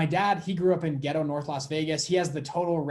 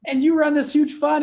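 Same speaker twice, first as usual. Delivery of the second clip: high, loud disbelieving cry at dad; shocked outburst; 11 semitones higher, 9 dB louder than usual. Retold or imitated. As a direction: blurt it out in total shock, much higher and louder. Shocked son confronting dad.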